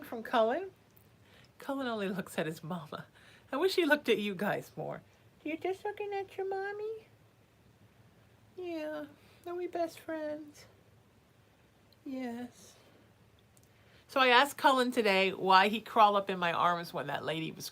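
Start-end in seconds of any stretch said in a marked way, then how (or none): 5.4-12.7 much higher cooing pet voice. Real, not acted. Mom coos at her cat Cullen, high baby talk.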